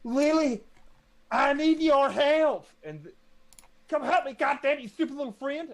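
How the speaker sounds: high, loud demanding shout; bossy yelling. Acted. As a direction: yell at her friend rudely. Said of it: "She's gonna go".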